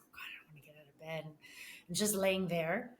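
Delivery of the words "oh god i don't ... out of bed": fast, breathy whisper of exhaustion; tired whisper. Retold or imitated. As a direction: whisper it like you can't get up. Tired in bed, groaning.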